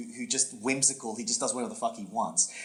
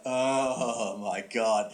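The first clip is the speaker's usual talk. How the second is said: long drawn-out "oh" of dawning dismay; slow, mock-agonized exclamation; exasperated realization; much slower than usual. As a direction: stretch it out very slowly, like a groan. Acts out reaction to final punchline.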